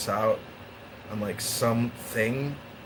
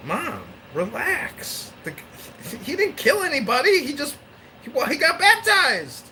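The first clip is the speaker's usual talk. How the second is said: mock dad; 3 dB louder than usual. Dad telling grandmother to relax, hammed-up high voice. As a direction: play the dad soothing mom in a silly high voice.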